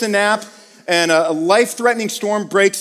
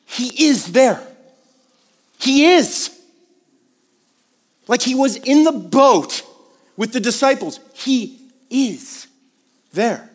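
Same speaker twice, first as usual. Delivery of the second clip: higher and louder, lingering between the words; emphatic declaration; 5 semitones higher, 3 dB louder than usual. Real, not acted. Preacher assuring the listeners God is with them, emphatically. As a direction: proclaim that he is there. Climactic declaration of God's presence.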